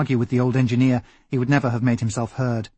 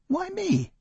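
much higher, thin put-on voice, quieter; a startled little yelp of a protest; squeaky Crum; 5 dB quieter than usual. Doctor Crum balks at being sent for the children, squeaky and high.

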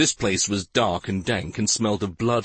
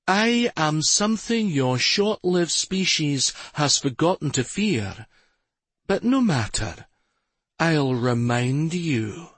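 menacing villainess; 6 semitones higher than usual. A narrator's reading.

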